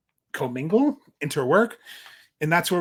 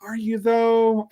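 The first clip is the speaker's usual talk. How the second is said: slow, higher doubting jab; skeptical maid; 6 semitones higher, much slower than usual. Retold or imitated. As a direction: drag it out with suspicion. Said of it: "Birdie, her maid, is like".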